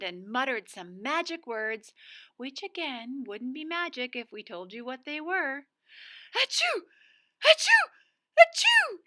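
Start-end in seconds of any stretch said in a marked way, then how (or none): 6.3-9.0 three high, loud explosive achoos; three sneezes. Acted. Mister Sneeze sneezes three times for the spell, loud sneezes. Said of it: "sneezed Mister Sneeze".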